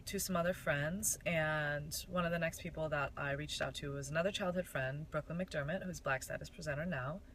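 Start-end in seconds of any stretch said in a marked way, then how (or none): none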